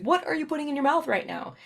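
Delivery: alarmed parent. Retold or imitated. Parent catching child eating non-food, mock alarm. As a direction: catch the kid mid-bite.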